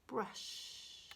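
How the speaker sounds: "brush" whispered with a long sh at the end. Shown as a demonstration. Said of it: "there's the sh at the end".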